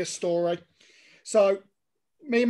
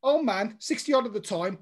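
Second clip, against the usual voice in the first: ordinary delivery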